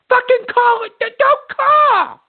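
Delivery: angry high-pitched outburst; mad screaming. Real, not acted. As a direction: lose your temper, pitch shooting up. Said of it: "getting mad and screaming".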